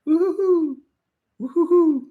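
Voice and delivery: high woo-hoo-hoo, over and over; mimicking the voice note cheers; several imitated takes. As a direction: play back the options, pitched high. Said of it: "I get a text of like".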